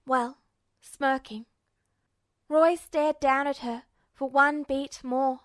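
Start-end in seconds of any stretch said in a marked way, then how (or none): none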